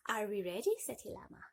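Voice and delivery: usual speaking voice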